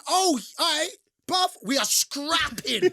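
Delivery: high, slow mock threat; mock tough inner voice. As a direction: play the cocky hard man in your head. Retold inner thought before fight.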